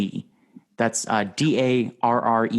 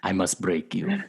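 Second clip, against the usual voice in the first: Danny DeVito as Drago; 4 semitones lower, 4 dB quieter than usual. Pretend. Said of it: "that was a good DeVito doing ... Ivan Drago".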